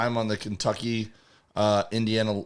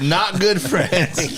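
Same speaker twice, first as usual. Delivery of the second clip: slow, raised imitation of the caller; mock caller retraction; 6 semitones higher, much slower than usual. Riffing on the caller changing his mind, slow mock caller voice. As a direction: stretch each word, pitched up, playing the caller.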